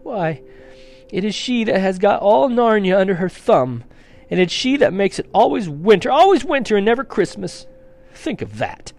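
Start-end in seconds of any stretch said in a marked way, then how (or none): 1.1-7.7 high, loud, building complaint; decry her with mounting agitation; impassioned lament. Acted. Fawn decries the Witch.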